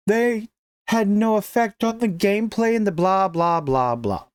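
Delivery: sneering mock of the developers' line; mocking excuse. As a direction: parrot their excuse with scorn.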